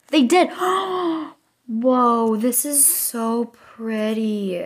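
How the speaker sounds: real thrilled outburst; excited gasp. Real, not acted. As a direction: gasp then gush in awe. Gasps at new hairstyles.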